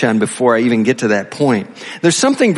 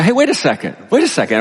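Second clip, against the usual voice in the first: higher-pitched mock objection; imagined objection; 7 semitones higher, faster than usual. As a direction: break in like a listener who spotted a problem. Voices a puzzled objecting listener.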